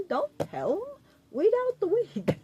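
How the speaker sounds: high, laughing mock warning.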